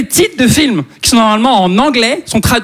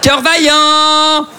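higher and very slow, laughing; drawn-out mock-solemn film title; mock grand title; 6 semitones higher, much slower than usual. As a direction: savor the silly title slowly. Mocking the translated title.

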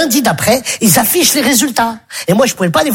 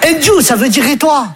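ordinary talk

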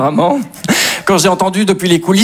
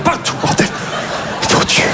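high breathy exasperated cry; exasperated outburst; 13 semitones higher, 4 dB quieter than usual. Real, not acted. Comedian exasperated at hearing Inch'Allah everywhere. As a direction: throw up your hands in mock despair.

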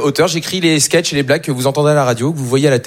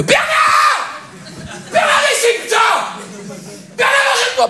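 high, slow full-force yelling; angry shout; slower than usual. Acted. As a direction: bellow the name with all your rage. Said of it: "cool à gueuler".

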